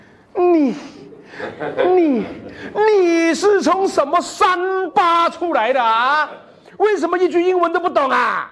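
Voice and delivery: high, shouted scolding with a laugh; mocking teacher. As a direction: ridicule the new boy in front of everyone. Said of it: "and he was like".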